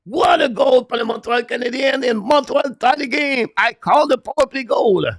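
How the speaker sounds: excited goal call. Real, not acted. Commentator calling the tying Montreal goal, screaming with joy. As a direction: erupt as the equalizer goes in, full voice. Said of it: "I'm excited, too excited".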